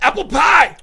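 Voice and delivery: high, loud sudden pretend shout; mock yelled outburst. Radio host suggesting harmless things to yell out, mock outburst. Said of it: "why she doesn't yell out".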